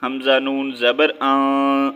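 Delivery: slow spelling, آن held through the nose; ghunna. Shown as a demonstration. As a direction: draw آن out into a nasal hum. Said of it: "غنہ کریں گے". (آن پر لمبا غنہ)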